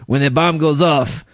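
comic put-on Eastern European accent. Host quotes a crash survivor, mock Eastern European accent. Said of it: "the Eastern European guy".

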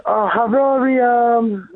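high, drawn-out mock Korean accent. Caller mimicking his boss, exaggerated Korean accent.

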